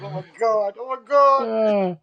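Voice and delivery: high, slow words broken by laughter; laughing oh my God. Retold or imitated. Friends watching the prank, laughing through the words. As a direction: gasp it out while cracking up. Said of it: "just dying laughing".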